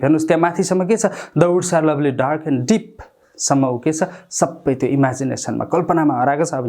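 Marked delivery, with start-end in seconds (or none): none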